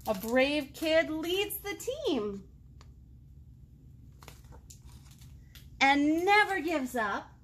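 slow, warm read-aloud of a picture book; storybook reading voice, slow and deliberate; reading to children. A narrator's reading. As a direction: savour each line for the little ones.

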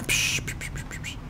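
soft, unvoiced shushes fading out; whispered shushes, fading away; shushing hiss. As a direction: hush yourself while sneaking.

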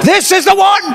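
high, loud, starry-eyed; gushing lovestruck. Pretend. Young person announcing they found the one, gushing. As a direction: gush like you've found the one.

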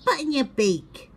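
mean brother shouting; shouted. A narrator's reading. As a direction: snap it at your little brother. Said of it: "shouted Boggle".

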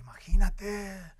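slow hushed whisper of imagínate; whispered grandfather. Retold or imitated. Grandfather whispering in awe to grandson.

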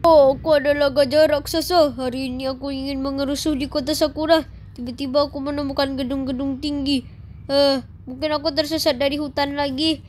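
low, lumbering giant elephant gleefully wrecking the town. Acted. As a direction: rumble it out like a huge clumsy elephant on a rampage. Kid plays rampaging giant elephant.

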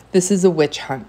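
usual speaking voice

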